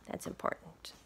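hushed confiding note; whispered aside. Real, not acted. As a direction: lean in and whisper it. Aside while reading to kids.

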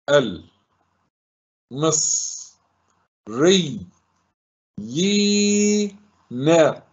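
isolated syllables, long yii held high. Shown as a demonstration. Teacher dividing the word for pupils, syllable by syllable. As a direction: sound out each piece with pauses between. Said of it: "ننطقها صوتًا صوتًا".

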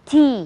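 "tea" with a heavier, held vowel; weighted vowel. Shown as a demonstration. Co-teacher honours the letter A, weighted vowel. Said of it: "หนักหน่อย".

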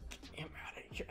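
urgent whisper. Retold or imitated. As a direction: hiss it under your breath. Girls urging her.

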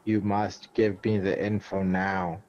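ordinary delivery

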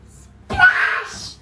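high, loud breathy splash; splash sound. Acted. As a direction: shout the splash into the water. Splash into the water.

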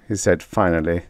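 ordinary delivery

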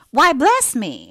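slow, loud, high burst of surprise; landlady startled into a glad cry; exclamation. A narrator's reading. Landlady suddenly recognising Margaret, loud high exclamation. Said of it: "exclaimed she".

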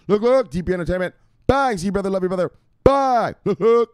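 high, loud catchphrase bursts; high hype-man calls; hyped shout-out. Pretend. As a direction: fire off excited greetings way up high. Calling out shout-outs to followers.

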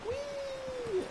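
gleeful whee. Retold or imitated. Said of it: "and everyone was like".

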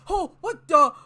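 loud, high startled cry; startled yelp. Real, not acted. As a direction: jump out of your skin. Jump scare in game.

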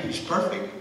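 ordinary talk

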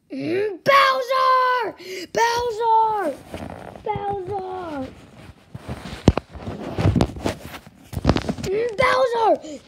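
calling Bowser to tattle. Acted. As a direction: shout across the house.

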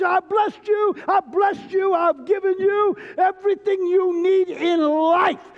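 loud, high proclamation as God; voicing God. Preacher booms God's words to the congregation, loud and high. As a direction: proclaim it like God blessing his people.

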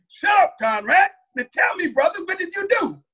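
high, rising demand; stern high shout. Retold or imitated. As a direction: snap at him and demand. Farrakhan demanding answer.

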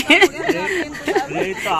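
a high bursting laugh among friends. Real, not acted. Laughing at friends joking about blood.

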